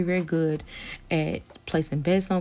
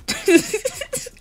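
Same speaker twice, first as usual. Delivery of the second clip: high shriek laugh; 6 dB louder than usual. Real, not acted. Laughing at herself.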